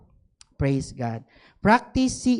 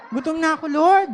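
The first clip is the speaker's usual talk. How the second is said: higher, half-laughing whine of hunger; whiny plea; 8 semitones higher, 4 dB louder than usual. Retold or imitated. Pastor whining to the Lord about hunger, half-laughing. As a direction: plead like a hungry child, laughing. Repeats the hungry complaint louder.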